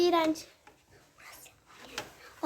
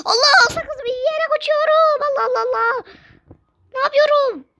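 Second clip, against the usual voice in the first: excited shouting; 11 semitones higher than usual. Real, not acted. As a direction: yell in alarm at the game. Panic in game, shouting.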